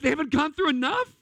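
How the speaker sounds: high, incredulous plea to God; anguished protest. Retold or imitated. Retold protest to God continues.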